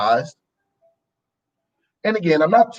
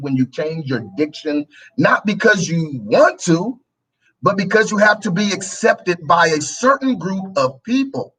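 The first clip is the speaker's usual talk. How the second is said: normal talking voice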